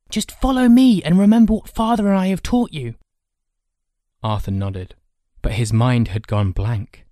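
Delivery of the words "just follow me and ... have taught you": put-on high, loud boy's voice; rally him, high and loud; commanding older brother. Older brother leading the younger into a fight, commanding.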